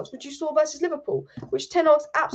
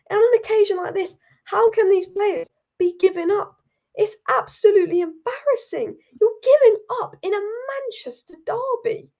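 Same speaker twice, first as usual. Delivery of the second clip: real outraged rant, pitched higher and louder; angry rant; 7 semitones higher, 4 dB louder than usual. Real, not acted. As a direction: blast the players for giving up the derby. Ranting about players giving up.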